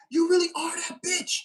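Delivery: high, loud, put-on sassy praise; sassy hype voice. Reader hyping up the viewer, sassy put-on voice. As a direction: hype them up like a bestie. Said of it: "I don't even talk like that".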